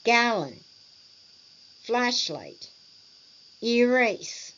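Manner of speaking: "Gallon, flashlight, erase" each hit on the first syllable, then falling; first-syllable stress. Shown as a demonstration. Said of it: "stress on the first syllable".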